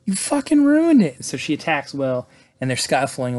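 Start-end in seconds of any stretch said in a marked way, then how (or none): 0.1-1.2 screamed high and loud in a rage; furious shout. Retold or imitated. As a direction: yell it at him like she's lost it. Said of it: "Sadie gets pissed, and she's like".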